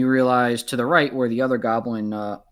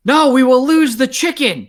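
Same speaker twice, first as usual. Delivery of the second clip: much higher, loud panic; panicked goblin; 12 semitones higher, 11 dB louder than usual. Acted. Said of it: "And the goblin says".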